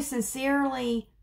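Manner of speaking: normal talking voice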